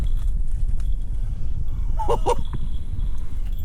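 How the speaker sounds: breathless, high-pitched cackle; high squealing laugh. Real, not acted. Seeing the dog up the tree, squealing laugh.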